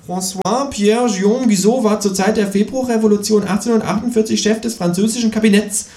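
high, smug kangaroo recital; trivia show-off. A narrator's reading. Kangaroo scores with trivia knowledge, smug and high. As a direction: rattle off the facts to show off. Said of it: "punkte das Känguru mit seinem Trivial Pursuit Wissen".

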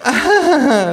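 high, loud forced society laugh; fake laugh. Hostess laughs artificially at the kangaroo's joke, forced. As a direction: put on a hollow polite laugh. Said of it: "lacht die Frau künstlich".

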